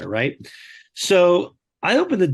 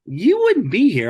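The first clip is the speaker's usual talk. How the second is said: defiant retort; 8 semitones higher, 3 dB louder than usual. Retold or imitated. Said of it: "I actually said this".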